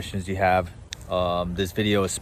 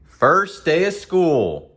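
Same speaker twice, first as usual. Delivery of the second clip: drawn-out announcement; 7 semitones higher, 6 dB louder, much slower than usual. Acted.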